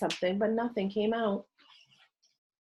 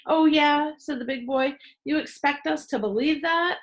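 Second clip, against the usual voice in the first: loud, higher scornful disbelief; scoffing challenge; 8 semitones higher, 4 dB louder than usual. A narrator's reading. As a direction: sneer at his claim. Leader scoffing at Henry.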